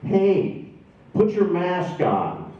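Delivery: high, loud barked order from a doorway; retold shout. Young man in doorway shouting at a passerby, loud and high. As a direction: yell it at a passer-by like a stranger scolding. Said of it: "he shouts at me".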